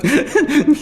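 high, painful howling laugh; stoned friend doubled over with laughter. Acted. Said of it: "Todd zginął się ze śmiechu".

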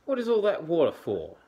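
high, loud, quick questioning; Platypus voice. Acted.

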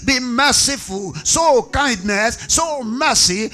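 impassioned, driving sermon delivery; full-force preaching. Real, not acted. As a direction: preach it out with forceful, punched phrases.

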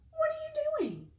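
very high sing-song question; innocently nosy storybook fox; inquisitive fox. A narrator's reading. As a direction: ask sweetly, way up high. Fox asks Bird, in character.

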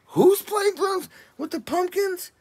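high, loud, slow incredulous cry; incredulous world. Retold or imitated. The whole world reacting to the news, high and incredulous. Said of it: "that's what the whole world said".

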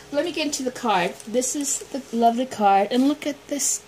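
ordinary talk

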